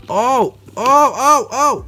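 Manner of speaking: high, loud string of startled cries; sudden panicky "oh"s; startled yelps. Real, not acted. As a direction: yelp in alarm as the spider moves. Tarantula moves, he cries out.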